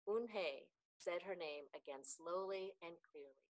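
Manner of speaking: usual speaking voice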